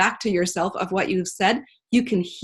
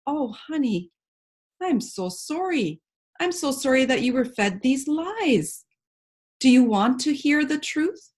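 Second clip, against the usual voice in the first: gentle; 5 semitones higher than usual. Retold or imitated. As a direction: soothe them kindly. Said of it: "you're like".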